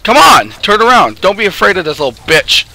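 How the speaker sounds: loud frustrated yelling at the screen; yelling at the game. Real, not acted. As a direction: shout at the teammate to turn around. Yelling at teammates in fight.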